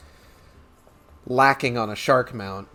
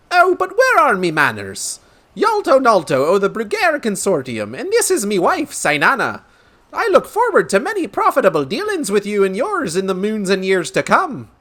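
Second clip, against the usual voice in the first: high, loud merchant in a wobbly brogue; hammed-up Irish-ish brogue, pitched up; put-on brogue; 9 semitones higher, 6 dB louder than usual. Merchant introducing himself and his wife, hearty put-on brogue. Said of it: "I don't know what accent this is turning into".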